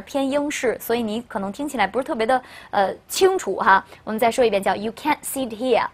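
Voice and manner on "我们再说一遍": ordinary delivery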